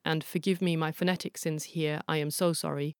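plain reading voice

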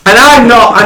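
usual speaking voice

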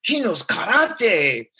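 lightly voiced admiring neighbor, drawn out; impressed neighbor. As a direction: neighbors watching the boy, slow and impressed.

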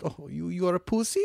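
put-on half-committed Italian accent; bad Italian accent. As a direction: tease in a clumsy Italian accent. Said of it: "We both suck at that accent".

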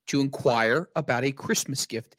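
usual speaking voice

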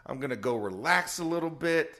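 high, loud, giddy cheer; delighted with the spare time; excited. Retold or imitated. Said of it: "I was like".

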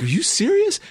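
high-pitched question of mock-stunned disbelief; incredulous. Retelling her answer to his proposal, incredulous. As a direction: lift it way up, not believing it.

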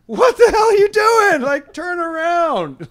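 very high, drawn-out exclamation; exasperated parent's squawk, imitated; retold horrified outcry. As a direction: stretch it out in high mock horror. Retold horror at child vomiting.

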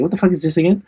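high-pitched mock bafflement; puzzled surprise. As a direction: act out opening a parcel you forgot ordering. Acting out opening a forgotten parcel.

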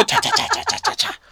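high whooshing cha sounds; imitated flying sandal whoosh. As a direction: mimic a sandal flying past.

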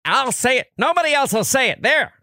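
high-pitched, insistent talk-radio impression; Bob Grant. Host doing the great radio veteran for a colleague, high insistent impression. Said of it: "who can do the great?".

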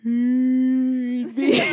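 slow, drawn-out broken toy moan; a moaning broken Furby; slow demonic voice. Retold or imitated. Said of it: "a slow demonic voice".

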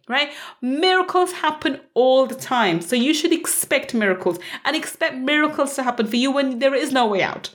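ordinary talk

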